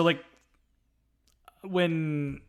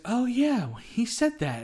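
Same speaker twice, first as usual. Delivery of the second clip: retold realization; 8 semitones higher, slower than usual. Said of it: "Buffy's like".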